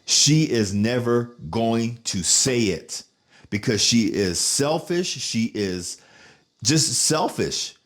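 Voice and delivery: very slow, word-by-word declaration; emphatic verdict. Retold or imitated. Nephew telling aunt who failed him, slow emphatic verdict. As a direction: spell out the bitter truth slowly.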